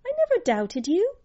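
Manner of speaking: put-on high, quick little-girl voice; quick high-pitched affectionate reply; laughing girl's reply. A narrator's reading. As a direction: answer lightly and high, a child confiding in a friend. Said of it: "She laughed and laced her fingers".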